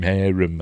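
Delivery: "harem" said as HAIR-um; "harem" starting like hair, unstressed -um; stress on first syllable. Shown as a demonstration. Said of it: "I don't know how to pronounce it".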